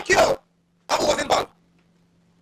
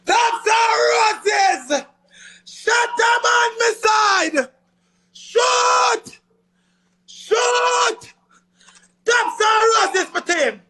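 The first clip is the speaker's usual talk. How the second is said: excited shouting; 8 semitones higher, much slower than usual. Real, not acted. Streamer hyping his team mid-match, shouting. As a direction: yell it out, hyped on the match.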